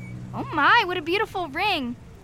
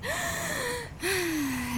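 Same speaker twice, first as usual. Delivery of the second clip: long high sigh of a deep breath out; demonstrating a deep breath out; deep exhale; 9 semitones higher, 6 dB quieter than usual. Shown as a demonstration. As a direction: breathe it all out for show. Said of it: "inhaling and exhaling like this".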